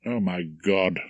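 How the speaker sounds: ordinary delivery, nothing marked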